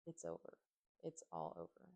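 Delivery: a low, distant voice of giving up; hollow despair. A narrator's reading. Friend's remembered words to Ruby, in a low hopeless voice.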